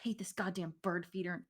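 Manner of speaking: mumbled, whispery griping to himself; grumbling. Acted. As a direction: grumble under your breath, quick and grudging.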